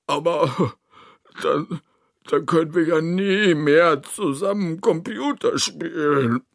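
falter, then wail it through sobs; sobbing. Acted. Narrator fears never playing with Toni again, sobbing. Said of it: "schluchze ich".

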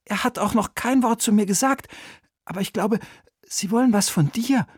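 put-on high, hesitant boy's voice; boy confiding. A narrator's reading. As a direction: confide a guess as a young boy. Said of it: "flüsterte Oliver Bertha zu". (hohe, vertrauliche Jungenstimme)

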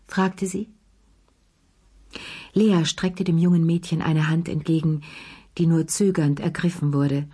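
plain reading voice

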